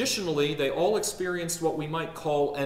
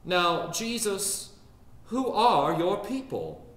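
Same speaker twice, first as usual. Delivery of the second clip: higher, slow Southern drawl; Richmond lady's drawl; 6 semitones higher, slower than usual. Retold or imitated. Richmond-style question put to Jesus, slow Southern drawl. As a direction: put the question in a drawn-out Richmond matron's accent. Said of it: "answering the question".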